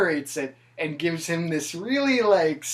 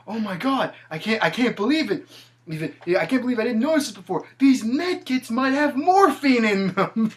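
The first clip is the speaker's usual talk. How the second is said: giddy excitement; 7 semitones higher, 5 dB louder than usual. Retold or imitated. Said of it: "And he goes".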